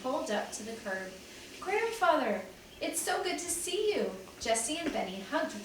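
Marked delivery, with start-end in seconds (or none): 1.6-4.1 high, bright-pitched happy greeting; children welcoming grandfather, excited. A narrator's reading. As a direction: greet him with joy.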